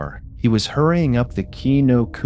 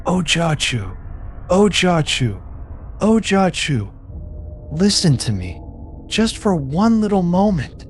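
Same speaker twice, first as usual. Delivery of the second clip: pleading in a raised, strained voice; high pleading call; 9 semitones higher than usual. A narrator's reading. Merchant begging the weeping woman to listen, pitched high and pleading. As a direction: beg her to turn around, voice climbing high. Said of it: "and pleaded".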